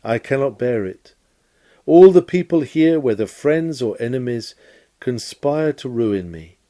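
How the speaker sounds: ordinary delivery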